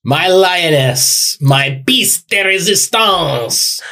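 high, slow theatrical delivery; grand dramatic. Pretend. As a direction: deliver it like a hammy crooner.

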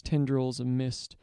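plain reading voice

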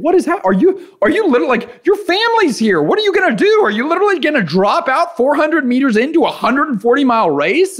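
frantic high-pitched inner voice mid-swim; panicked self-talk. Retold or imitated. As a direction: voice shoots way up, frantic and pleading. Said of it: "I'm just freaking out".

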